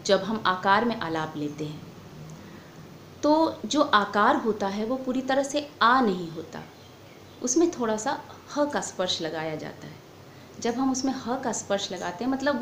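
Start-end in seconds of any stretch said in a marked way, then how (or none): none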